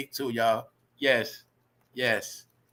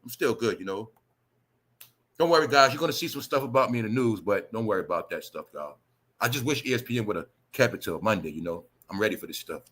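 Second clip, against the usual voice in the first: softer, breezy fake-calm voice; mocking Shannon; 7 semitones lower, 4 dB quieter than usual. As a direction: brush it off like nothing happened, softly. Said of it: "wanna come back like nothing happened".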